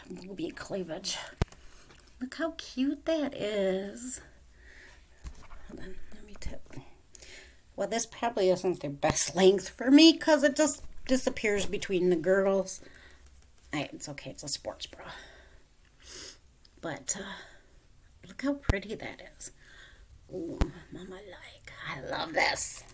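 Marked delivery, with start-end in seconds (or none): 0.1-6.6 whisper. Real, not acted. Whispering over the necklace. 13.7-22.8 whisper. Real, not acted. Soft whispered admiration.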